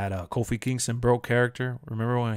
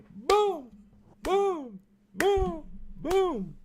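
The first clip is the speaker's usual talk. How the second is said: high mock crowd-chant boom; Kofi Kingston boom; much slower than usual. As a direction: shout each boom up high like the wrestler. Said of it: "I did the Kofi Kingston".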